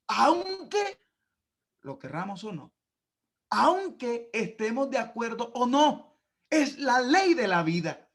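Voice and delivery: fervent pulpit preaching, rising and loud; preaching at full force. Acted. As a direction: lift the congregation, preach it out loud. Preaching climax, raised voice.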